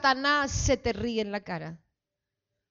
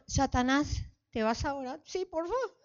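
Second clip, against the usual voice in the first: meek, softer, pleading voice; timid rebuke; 2 semitones higher, 10 dB quieter than usual. Shown as a demonstration. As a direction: ask the devil politely, weakly. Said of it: "No, así, ah, no se va a ir". (reprensión tímida y suave)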